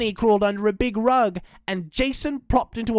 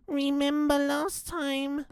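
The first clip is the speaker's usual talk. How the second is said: put-on high, soft, slow frightened child; shivering with fear; 8 semitones higher, 6 dB quieter, slower than usual. A narrator's reading. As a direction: warn them timidly, small and drawn out.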